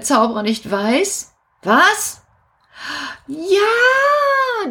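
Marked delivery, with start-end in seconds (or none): 3.3-4.7 excited cry. Acted. Kasperl's sudden idea.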